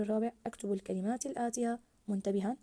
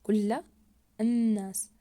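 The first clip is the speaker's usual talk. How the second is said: "كل الناس" louder, ل and ن held long; stressed doubled letters; 5 dB louder, much slower than usual. Shown as a demonstration. Teacher demonstrating the shadda to pupils, stressed doubled letters. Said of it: "نشد على الحرف المضاعف عند اللفظ عليه".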